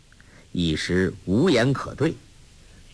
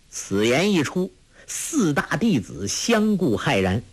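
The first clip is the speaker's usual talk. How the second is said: plain reading voice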